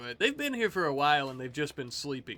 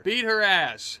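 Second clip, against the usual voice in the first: loud egging on; 4 semitones higher, 7 dB louder, slower than usual. Real, not acted. Urging colonists in melee.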